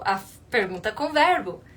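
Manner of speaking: ordinary talk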